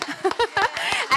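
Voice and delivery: high, excited laugh. Real, not acted. Host asking audience to stand, laughing. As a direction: giggle brightly before the big welcome. Laughs before welcoming the speaker.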